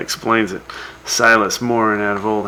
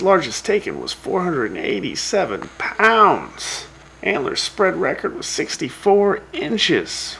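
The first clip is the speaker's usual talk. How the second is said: higher, swooping radio announcer read; 4 semitones higher, slower than usual. Acted. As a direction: announce the next category with showy emphasis. Announces next category on radio.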